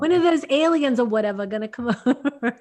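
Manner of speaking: loud mock New York questioner; jokey, loud New York accent. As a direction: put on New York, breaking into a laugh. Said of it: "do a good New York one".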